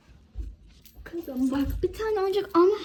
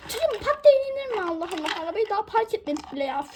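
scolding mother; 6 semitones higher, 3 dB louder, faster than usual. Acted.

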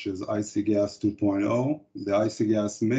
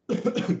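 cough; 13 semitones higher than usual. Real, not acted. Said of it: "I'm coughing a little bit".

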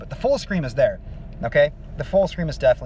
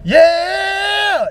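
high rough sung scream; fry scream attempt; 9 dB louder than usual. Shown as a demonstration. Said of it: "when I'm in that part".

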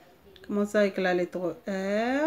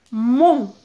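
loud, isolated syllable; emphatic dictation; 8 dB louder than usual. Shown as a demonstration. Teacher repeats a dictated syllable to children, emphatically. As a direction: dictate it clearly to the class.

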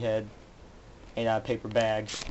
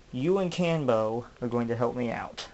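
usual speaking voice

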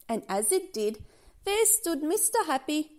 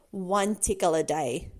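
low, slow warning; firm reminder; 5 semitones lower than usual. Acted. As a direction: lay down the rule slowly. Stern reminder of the rule.

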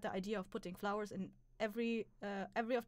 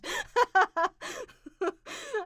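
high, loud cackle of laughter; 12 semitones higher, 11 dB louder than usual. Real, not acted. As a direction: crack up loudly. Laughs after "someone clip that.

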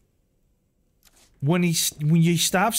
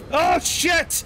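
panicked yelp; 9 semitones higher than usual. Real, not acted. Chased by dogs.

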